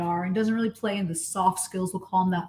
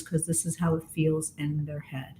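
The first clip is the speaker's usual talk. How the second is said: flat monotone voice, lower; 6 semitones lower, 6 dB quieter than usual. Shown as a demonstration. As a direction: dull monotone, checked out. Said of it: "I'm using this monotone voice".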